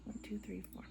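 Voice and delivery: counting stitches in a whisper. Real, not acted. Crocheter counts stitches to herself in a whisper. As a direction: breathe the numbers out under her breath.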